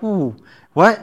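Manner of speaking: taken aback, mock-startled double take; startled question, lightly hammed up; shaken. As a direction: act out a shaken captain's disbelief. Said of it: "kinda shook the, the, that chief captain up".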